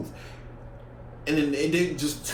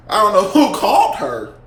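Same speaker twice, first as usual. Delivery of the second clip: louder, higher exclaim; loud outburst; 5 semitones higher, 9 dB louder than usual. Real, not acted. Telling the story, bursts out loud. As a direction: blurt it out loud.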